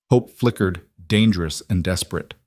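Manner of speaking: ordinary delivery, nothing marked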